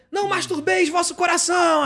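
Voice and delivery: booming pastor parody after service; mock preacher. Shouting a mock sermon to the church crowd. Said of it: "aí eu vinha eu".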